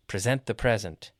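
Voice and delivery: first PRESENT pre-ZENT, second PREZ-ent; contrasting syllable stress. Shown as a demonstration. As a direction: stress verb PRESENT late, noun PRESENT early. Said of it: "verb gets the stress on the second".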